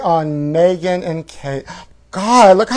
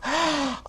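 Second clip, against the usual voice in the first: gasp; 10 semitones higher, 9 dB quieter than usual. Real, not acted. Reacting to the comeback.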